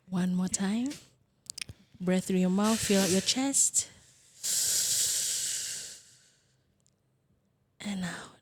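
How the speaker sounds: calm, soft meditation guide; guided meditation. Acted. Leads a breathing exercise.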